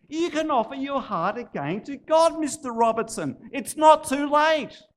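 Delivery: high, loud, fervent appeal; impassioned plea. Acted. Woman pleads with the poet.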